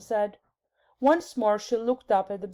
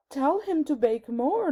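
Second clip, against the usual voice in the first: lightly voiced Queen; 6 semitones higher than usual. A narrator's reading. Queen's curt command.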